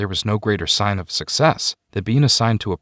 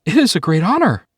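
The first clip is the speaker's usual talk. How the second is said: breathless and much higher with joy; happy gasp; 10 semitones higher, 4 dB louder than usual. Acted. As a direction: gasp it out happily. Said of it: "Elia gasped happily".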